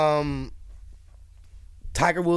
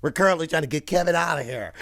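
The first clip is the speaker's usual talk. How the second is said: ordinary talk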